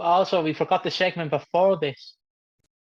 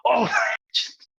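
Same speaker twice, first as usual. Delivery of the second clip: excited yell; 7 semitones higher than usual. Retold or imitated. Continues the bathroom shouting.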